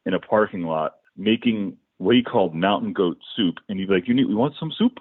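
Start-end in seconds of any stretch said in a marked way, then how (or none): 4.1-5.0 high-pitched, heavily accented bandmate, imitated; Borat-like heavy accent. Bandmate offering soup in a parking lot, in a heavy Borat-like accent. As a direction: mimic a thick Borat-style accent. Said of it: "he spoke, uh, basically like Borat".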